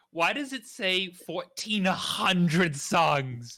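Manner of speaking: loud, drawn-out baffled question; confused. Real, not acted. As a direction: stretch the number out in disbelief. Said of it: "I'm confused".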